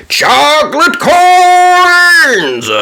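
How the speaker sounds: very slow, high, loud showman shout; pirate shouting to the audience. A narrator's reading. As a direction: announce it like a big reveal. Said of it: "shouted Pirate Pete".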